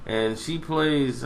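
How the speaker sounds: ordinary talk, nothing marked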